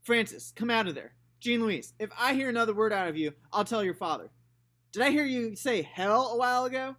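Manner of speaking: put-on high, loud scolding old lady; scolding grandma. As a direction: call out a sharp warning from the porch.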